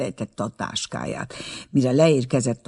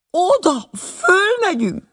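much higher, louder, slow panicked cry; frightened cry; 5 dB louder, slower than usual. A narrator's reading. Terrified aunt crying out at the cable car, panicked high cry. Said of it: "kiáltotta".

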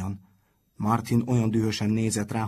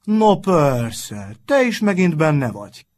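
loud, higher mocking call; loud jeering shout; 7 semitones higher, 11 dB louder, slower than usual. A narrator's reading. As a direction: call it out loud across the room. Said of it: "kiáltotta".

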